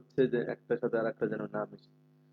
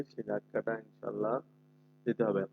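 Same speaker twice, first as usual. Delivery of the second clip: ordinary talk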